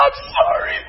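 imitated tearful wail, very high; mock crying in a thin, high voice; bawling. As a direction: squeak out a sobbing apology as the wife. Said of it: "she's bawling".

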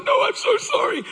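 very high imitation of an insistent wife; insistent apology. Wife to husband who tries to stop her, pleading.

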